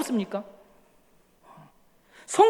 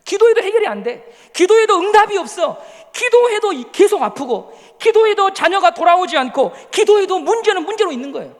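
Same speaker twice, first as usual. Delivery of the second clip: higher and louder, driving each 기도해도 home; building preaching; 6 semitones higher, 6 dB louder than usual. Real, not acted. Preacher louder to the congregation about unanswered prayer.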